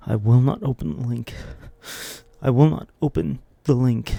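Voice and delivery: loud firm vow, stressing will. Acted. Tempted viewer swearing off the link, loud firm vow. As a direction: swear it to yourself. Resolving not to click.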